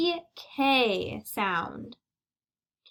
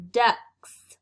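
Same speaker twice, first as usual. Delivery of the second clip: ducks said louder, with the final k clearly sounded; stress on ducks; 2 semitones lower, 5 dB louder than usual. Shown as a demonstration. Teacher highlights the k sound for pupils, stressing ducks. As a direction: punch ducks and pop its k. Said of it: "I heard the k sound in the word".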